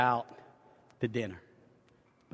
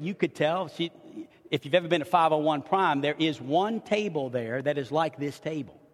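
usual speaking voice

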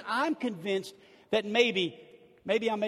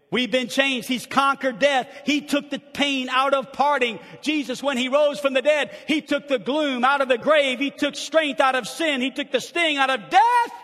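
loud rolling preaching, building on each line; 5 semitones higher, 7 dB louder than usual. Acted. Pastor exulting over the resurrection, loud preaching. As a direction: drive each line home to the church.

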